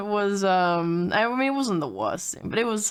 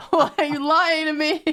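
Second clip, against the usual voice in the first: high protest through laughter; laughing mock accusation; 6 semitones higher than usual. Joking retort to the dog walker's kindness, laughing mock accusation. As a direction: wail it jokingly, cracking up.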